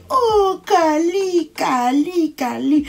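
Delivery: high, falling sympathetic coo; pitying Kali, Kali, Kali; mock pity. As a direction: coo the name in fake sympathy, starting high. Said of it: "tout le monde va dire".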